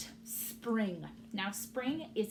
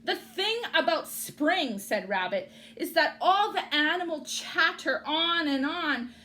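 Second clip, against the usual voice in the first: grumpy rabbit; 7 semitones higher, 7 dB louder than usual. Acted. Rabbit complains.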